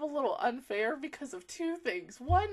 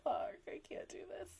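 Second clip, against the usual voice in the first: breathless, much softer whisper; 6 semitones higher, 14 dB quieter, faster than usual. Real, not acted. Overwhelmed fan to viewers, whispered.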